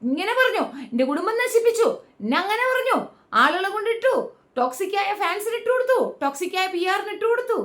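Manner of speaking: high, whiny mockery of Akbar's complaints; mocking whine. Mocking Akbar's complaints about Anumol in a high whiny voice. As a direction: squirm and whine like a self-pitying contestant. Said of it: "Akbar കിടന്ന് ഞെളിഞ്ഞ് ഞെളിഞ്ഞ് ഞെളിഞ്ഞ്".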